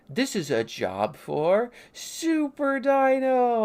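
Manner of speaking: slow, high, loud hero call; superhero proclamation. Acted. Tay facing a lava river, superhero proclamation. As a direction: announce yourself like a cartoon superhero.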